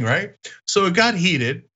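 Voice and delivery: ordinary delivery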